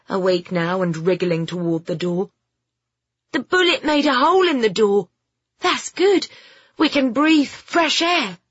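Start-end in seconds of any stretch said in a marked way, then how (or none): 3.3-8.4 put-on high, excited boy's voice; pitched-up, animated youngster; eager boy Alfie, excited. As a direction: share a lucky discovery eagerly. Story figure, excited boy.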